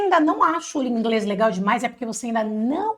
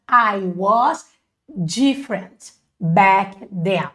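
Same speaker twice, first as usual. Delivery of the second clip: very slow, lower English; slow model phrase; 4 semitones lower, much slower than usual. Shown as a demonstration. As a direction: spell the phrase out word by word. Teacher models English phrase slowly.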